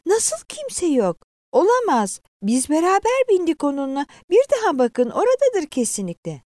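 high-pitched alarmed disbelief; distraught woman insisting, voice high; horrified. A narrator's reading. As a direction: protest in rising panic. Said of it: "Kadın dehşetle sarsıldı".

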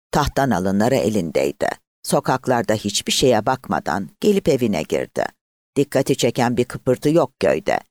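plain reading voice

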